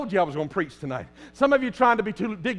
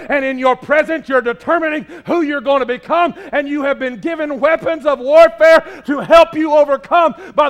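louder, higher preaching push; fervent preaching; 5 semitones higher, 6 dB louder than usual. Acted. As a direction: drive it home to the congregation. Preaching rises again.

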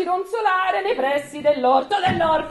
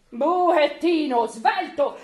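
usual speaking voice

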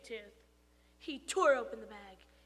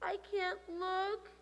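mild whimper, higher and slow; 6 semitones higher, slower than usual. Acted. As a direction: whimper it lightly. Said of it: "whimpered his sister Allison".

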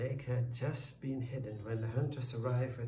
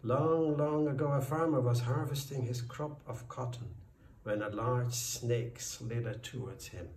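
plain reading voice

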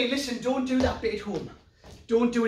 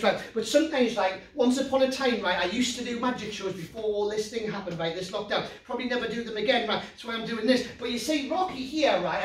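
normal talking voice

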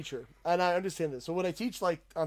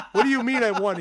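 higher, louder baffled question; stunned disbelief; 5 semitones higher, 8 dB louder than usual. Retold or imitated. Wrestler asking coach in shock, baffled disbelief. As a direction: ask it completely thrown. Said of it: "And I'm like".

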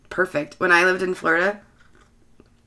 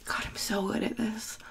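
whispered aside; 15 dB quieter than usual. Real, not acted. Sarcastic aside about self.

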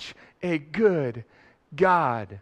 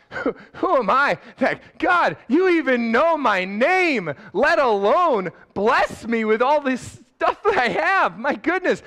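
higher and slower in amazed disbelief; rising wonder; awed exclamation; 8 semitones higher, 5 dB louder than usual. Real, not acted. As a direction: marvel at being blessed at all. Preacher's own awed exclamation.

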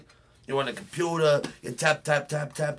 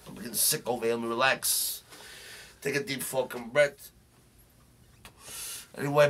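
usual speaking voice

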